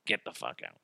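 low, very quiet, deadpan; bored wizard dismissal; half-assed wizard catchphrase. Acted. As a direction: toss off the catchphrase with no effort.

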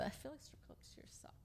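whispered aside. Real, not acted. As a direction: hush it like a secret. Aside confided to the table.

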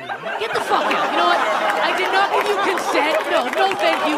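high, loud indignant tirade; furious outburst. Acted. Woman kicking out the man who whispered thanks, furious yelling. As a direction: blow up and throw them out.

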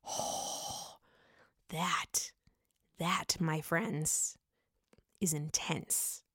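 very soft, slow and low; near-whispered, weighty reaction; hushed awe. Real, not acted. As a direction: breathe it out, slowly letting it sink in. Awed reaction to whispered warning.

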